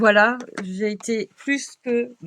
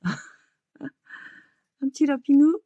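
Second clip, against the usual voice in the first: cooing with laughter; 5 semitones higher, 4 dB louder, much slower than usual. Real, not acted. Cooing over cute card.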